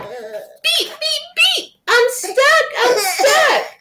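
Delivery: high squeaky mock-panicked truck; little truck in distress. A narrator's reading. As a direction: plead like a tiny trapped truck. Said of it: "cried the little blue truck".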